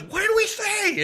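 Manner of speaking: high, slow indignant call; scolding. Acted. Page to a fan who broke the rules, scolding.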